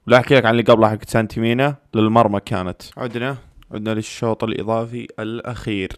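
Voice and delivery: ordinary talk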